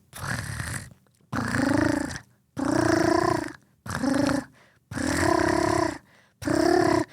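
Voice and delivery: long rolled trilling purrs; loud cat purr. Retold or imitated. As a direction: imitate a cat purring loudly. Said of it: "she is the loudest fucking purr".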